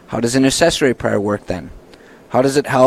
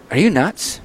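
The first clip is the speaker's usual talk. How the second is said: incredulous donor; 4 semitones higher than usual. Retold or imitated. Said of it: "they may look at you like".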